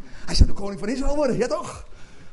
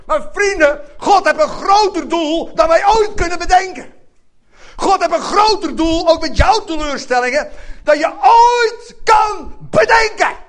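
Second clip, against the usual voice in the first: louder and higher until you shout it; fervent preaching; 8 semitones higher, 6 dB louder than usual. Acted. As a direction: preach it home, building to a cry.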